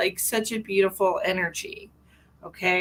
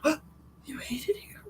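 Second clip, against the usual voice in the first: very soft, higher wounded question; mock hurt question; 5 semitones higher, 8 dB quieter than usual. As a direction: act out a tiny, hurt reply. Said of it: "like, misinterpreted that".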